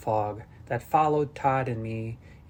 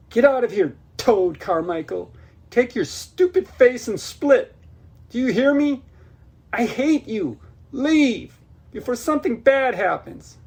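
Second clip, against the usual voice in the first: furious high yell, much louder; angry shouts; 11 semitones higher, 8 dB louder than usual. Acted. Girl screaming at Toad to get out. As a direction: scream at him to leave, full force. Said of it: "my angered shouts".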